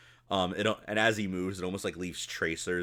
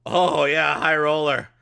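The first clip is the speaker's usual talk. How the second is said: loud, raised-pitch goblin bookie; mock-impressed goblin; 7 semitones higher, 9 dB louder, slower than usual. Goblin looking into a small bag of gold, mock-impressed. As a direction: play up being impressed, loudly. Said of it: "looks at the bag and goes".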